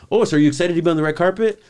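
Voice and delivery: high, loud, fast gushing interviewer imitation; imitated over-eager reporter; mock interviewer. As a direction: chirp the question like a red-carpet host. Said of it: "She's saying".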